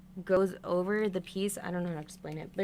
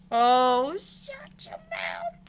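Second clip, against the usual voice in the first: shocked disbelief; 8 semitones higher, 6 dB louder, much slower than usual. Real, not acted. Reacting to mistake.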